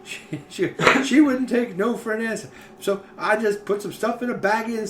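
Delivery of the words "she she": normal talking voice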